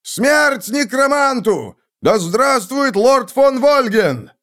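high, drawn-out rallying shout; battle cry. A narrator's reading. Soldiers raising swords and cheering their lord, loud shouting. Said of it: "Его люди закричали".

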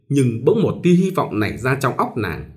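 usual speaking voice

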